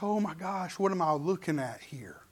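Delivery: low, soft, half-whispered mock amazement; hushed awe. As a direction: murmur it under your breath, overwhelmed. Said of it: "It'd be a little bit like".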